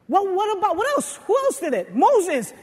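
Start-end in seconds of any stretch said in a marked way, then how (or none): none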